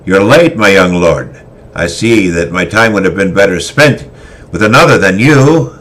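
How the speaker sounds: a callous veteran scolding his tardy lord; stern old swordmaster. Acted. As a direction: bark it like a hardened warrior scolding. Christoph scolds late pupil.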